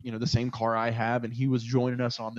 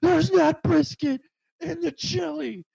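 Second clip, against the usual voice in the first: high, slow mock yell from the kitchen; angry cook; 4 dB louder, slower than usual. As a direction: bark the correction like a fed-up cook. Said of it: "fucking cook from the back goes".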